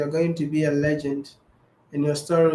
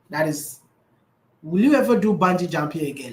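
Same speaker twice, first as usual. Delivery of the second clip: ordinary delivery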